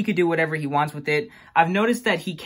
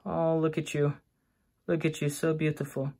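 cooing; 7 dB quieter than usual. Real, not acted. Cooing at pet spider.

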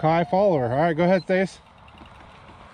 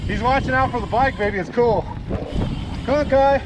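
calling from far away; 8 semitones higher than usual. Real, not acted. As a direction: call out to the running dog. Calling dog on trail.

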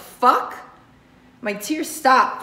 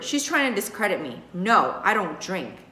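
ordinary delivery

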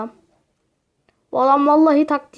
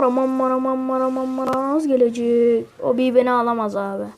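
usual speaking voice

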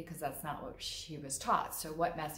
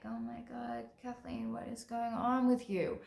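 higher, hushed shocked friend; 6 semitones higher than usual. Retold or imitated. Friend reacting to Kathleen's outburst, hushed disbelief. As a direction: stare at her, aghast. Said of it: "my friend is like".

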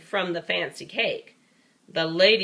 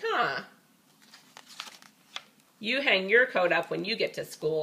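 usual speaking voice

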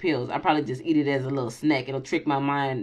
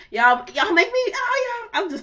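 squealing with delight at the viewers; excited squeal; 3 dB louder than usual. Real, not acted. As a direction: voice leaps very high with joy. Gushing to viewers.

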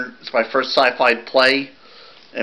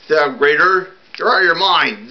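defiant retort; 5 semitones higher, 3 dB louder than usual. Acted. Defiant exchange before a fight.

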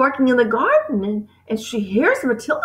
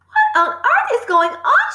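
high, drawn-out cry of disbelief; alarmed cry; 12 semitones higher, 4 dB louder, slower than usual. A narrator's reading. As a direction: cry it out in shock, pitched high. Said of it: "she cried".

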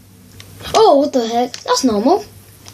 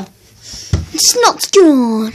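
drawn-out slow; much slower than usual. Pretend. Telling viewers the snot is gone, very slow drawn-out. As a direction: stretch every word way out, very slow. Repeats line, second time drawn out.

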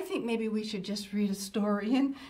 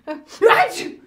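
loud hammed-up sneeze, higher; 8 semitones higher, 15 dB louder than usual. Grandma can't stop sneezing, loud fake sneeze. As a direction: play the sneeze big for kids.

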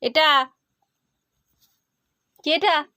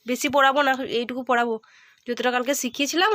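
ordinary talk

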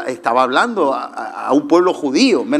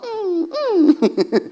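high laugh. Real, not acted. Laughing at dog memory.

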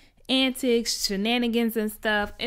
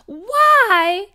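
much higher and louder, incredulous; outraged exclamation; 14 semitones higher, 10 dB louder than usual. Real, not acted. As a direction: shout the question in disbelief. Outraged at grandfather's remark.

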